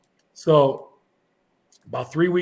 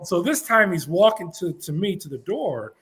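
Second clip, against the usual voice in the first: usual speaking voice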